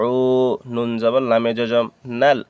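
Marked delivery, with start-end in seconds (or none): 0.1-0.1 "উ" held as a long, steady oo; drawn-out "উ"; one-alif stretch. Shown as a demonstration. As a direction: stretch "উ" for an extra beat. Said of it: "এক আলিফ টেনে নিতে হবে".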